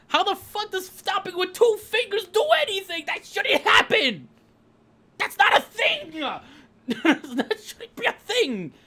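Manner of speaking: high-pitched disbelief, slowing on the ends; incredulous. Real, not acted. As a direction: cry out in disbelief. Incredulous rant about finger stop.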